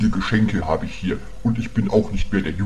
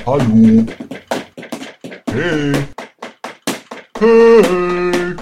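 loud high calls; calling out; 11 semitones higher, 7 dB louder, much slower than usual. Acted. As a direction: shout over the music. Calling Karol over loud music. (laut rufend)

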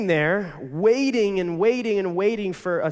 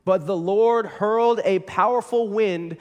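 a little louder, telling of the violent storm; dramatic scripture reading; 3 semitones higher, 4 dB louder than usual. Acted. Pastor reading the storm verse to the church, dramatic.

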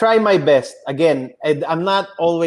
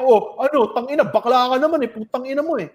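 higher-pitched, quick swearing back; retold angry insult; 7 semitones higher, faster than usual. Line cutter cursing back at him, retold heated insult. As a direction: mimic an aggressive line cutter.

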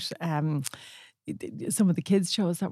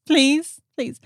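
higher, louder mock plea; tearful begging; 7 semitones higher, 4 dB louder than usual. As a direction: wail it like a pestering child. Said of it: "then I would cry".

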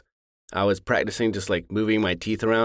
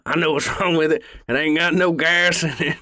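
higher Southern country voice, bottom teeth pushed out; country voice with jutting teeth; 7 semitones higher than usual. Shown as a demonstration. Demoing his country villain voice for friends. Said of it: "speak with my teeth like that".

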